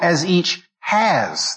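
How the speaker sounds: "has" stretched out, high then falling; stress on has. Shown as a demonstration. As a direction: draw out "has" very slowly and firmly. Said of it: "the emphasis is has".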